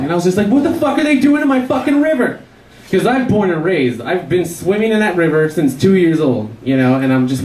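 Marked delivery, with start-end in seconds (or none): 0.5-2.4 high, indignant question; outraged. Retold or imitated. Comic sees workers in his river, asks high and indignant. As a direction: protest as if it's your river.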